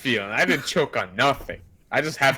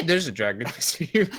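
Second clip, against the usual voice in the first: normal talking voice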